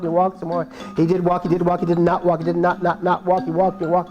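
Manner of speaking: ordinary delivery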